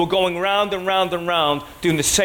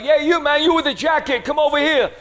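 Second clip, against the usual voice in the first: high, raised mock holler; calling someone over; 11 semitones higher than usual. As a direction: beckon a stranger from across the court. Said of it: "he's, like, calling me over".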